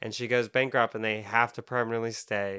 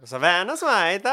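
mock European accent, higher and louder; imitating a European accent; 7 semitones higher, 6 dB louder than usual. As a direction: parrot the friend's take as if European. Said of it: "You said it like a Euro".

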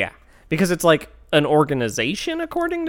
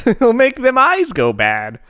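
slow, higher country drawl; folksy mock voice; 10 semitones higher, 6 dB louder, slower than usual. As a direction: warn like a fussy grandma.

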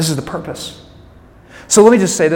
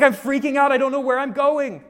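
mock panic; 7 semitones higher than usual. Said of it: "You're like".

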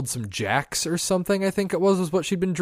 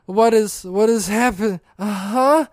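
groggy alarm; 6 semitones higher, 5 dB louder, slower than usual. Retold or imitated. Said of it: "And I'm like".

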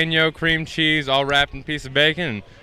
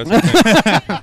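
mock agreement; 6 semitones higher, 6 dB louder, slower than usual. Repeats point in mock tone.

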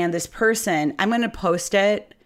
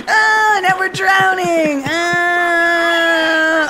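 high, loud hammed-up cry of alarm; playful fake terror, shouting high; mock panic shout; 11 semitones higher, 6 dB louder, much slower than usual. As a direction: yelp like the car is going under. Mock panic cry over music.

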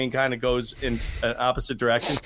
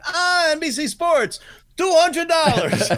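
high, loud, slow gleeful cop, laughing; a delighted cop spotting a bigger bribe, slow and loud; greedy gleeful cop; 12 semitones higher, 5 dB louder than usual. Retold or imitated. Cop hears NBC, raises fine.

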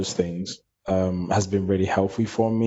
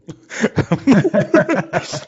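high burst of laughter; 12 semitones higher, 5 dB louder than usual. Real, not acted. Laughs at own answer.

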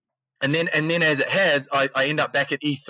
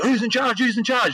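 mock urgent demand, pitched much higher; retold screamed demand; 10 semitones higher than usual. Armed cop to the pinned crowd, mock high-pitched yell. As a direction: imitate a cop yelling, high but not full volume. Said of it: "like, getting screamed at".

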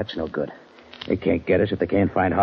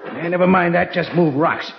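impatient; 9 semitones higher than usual. Acted. Boss snapping at his partner while digging, impatient. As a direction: cut him off and push on.

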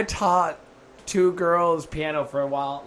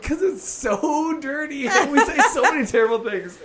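higher, laughing as it speeds up; laughing confession; 7 semitones higher than usual. Retold or imitated. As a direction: giggle through the admission. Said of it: "I'm like".

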